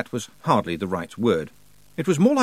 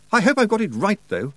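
put-on nervous young man; 11 semitones higher, 4 dB louder than usual. Roland speaking.

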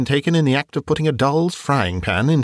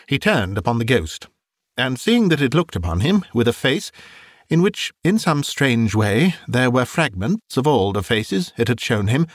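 ordinary delivery

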